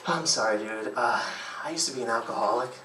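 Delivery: low, subdued confession; imitating a dejected guy owning up; mock sad, voice dropping soft; all sad. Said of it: "he gets all sad".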